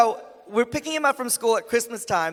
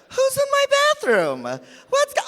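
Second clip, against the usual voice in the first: very high alarmed cry; hammed-up panicked squeal; mock panic; 3 dB louder, slower than usual. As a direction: call out as if startled by an intruder. Waking in a stranger's room.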